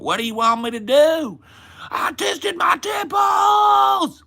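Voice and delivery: put-on high-pitched Southern-accented shout; Boogie, pronounced Southern accent. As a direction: wail it very high and loud in a mock Southern accent. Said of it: "he's very pronounced Southern accent".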